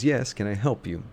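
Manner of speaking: polite shop clerk greeting; bright helpful shopkeeper voice; customer service voice. Acted. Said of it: "sort of adopts her customer service voice".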